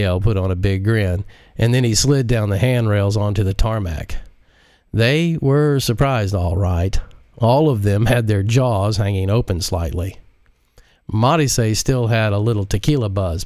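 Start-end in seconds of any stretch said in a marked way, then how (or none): none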